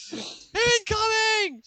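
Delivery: stretched-out high, slow alarm call "Incoming!"; a cartoonish warning wail, drawn out; mock alarm cry. Bankers hear the green bubble crash, hammed-up slow high alarm. Alien crashes onto planet.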